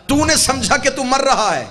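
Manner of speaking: high, loud sermon climax building to a shout; full-force preaching. Real, not acted. Preacher contrasts birth with death for congregation, high full-force preaching. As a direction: drive it home at full voice, pitched high.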